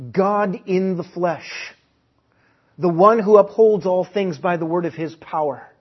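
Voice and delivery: rising, forceful sermon delivery; preaching at full force. Real, not acted. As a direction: build it up like a preacher.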